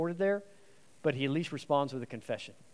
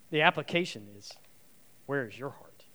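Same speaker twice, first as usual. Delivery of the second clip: usual speaking voice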